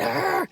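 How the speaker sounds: high mock bear roar; imitated bear growl. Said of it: "got up and went".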